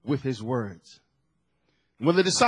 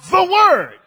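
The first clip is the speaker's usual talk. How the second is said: loud, raised preaching voice; forceful preaching; 5 semitones higher, 7 dB louder than usual. Real, not acted. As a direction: drive each phrase home to the congregation.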